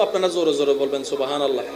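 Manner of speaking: a soft, low সুবহানাল্লাহ; said softly. Shown as a demonstration. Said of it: "আমি এখন আস্তে আস্তে বলবো".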